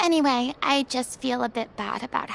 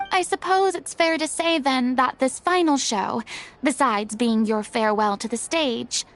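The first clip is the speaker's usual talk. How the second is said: ordinary delivery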